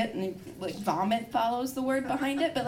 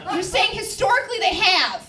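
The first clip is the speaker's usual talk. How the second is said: high, shouted disclaimer; loud protest; 9 semitones higher, 5 dB louder than usual. Pretend. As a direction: protest over the laughing crowd. Protests over the audience's reaction.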